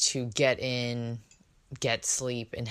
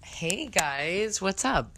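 high greeting with a crack in the voice; 7 semitones higher, slower than usual. Real, not acted. Podcast host greeting listeners, voice cracking. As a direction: let the pitched-up voice break slightly. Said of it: "did you hear that crack".

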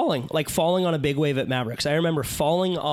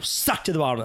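ordinary talk

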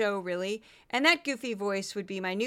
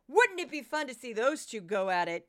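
ordinary delivery

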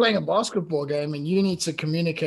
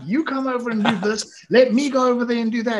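mock bossy; 7 semitones higher, 8 dB louder than usual. Retold mocking request, laughing.

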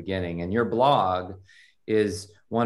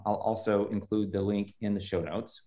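ordinary delivery

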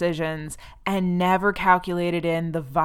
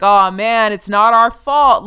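dismissive rock star; 3 semitones higher, 10 dB louder, slower than usual. Retold or imitated. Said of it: "And he's like".

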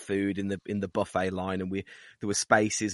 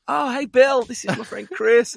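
mock excited hello; 12 semitones higher, 5 dB louder than usual. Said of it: "Gendel's like".

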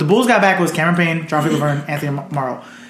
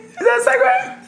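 higher, laughing incredulous repeat; laughing disbelief. Real, not acted. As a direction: crack up while asking. Repeats, cracking up.

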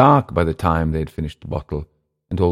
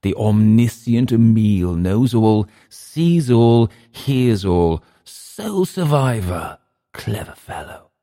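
sarcastic mock praise; 5 semitones higher, 5 dB louder, slower than usual. A narrator's reading. Sarcastic drunk taunting.

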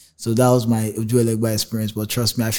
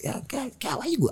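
mother's voice; 13 semitones higher, 10 dB quieter than usual. Retold or imitated. Mom scolding him.

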